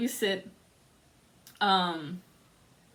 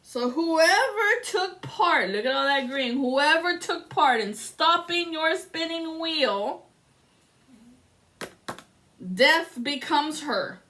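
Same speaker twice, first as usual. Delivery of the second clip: dramatic slow pronouncement; 5 semitones higher, slower than usual. Acted. Tarot reading, dramatic.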